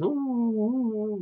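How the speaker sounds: high mock hooting; clown hooting. Killjoy playing with his teeth, high mock hooting. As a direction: hoot high like a cackling clown.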